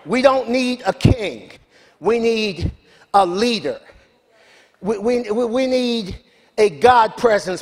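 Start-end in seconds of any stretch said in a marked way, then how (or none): none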